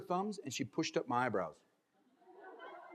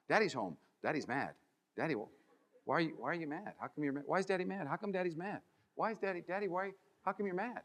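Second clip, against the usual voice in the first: put-on softer, lower, timid children's questions; a hint of small kids worrying; kids asking; 3 semitones lower, 7 dB quieter than usual. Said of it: "I got this a lot".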